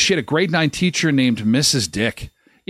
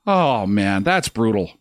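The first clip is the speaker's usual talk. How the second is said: normal talking voice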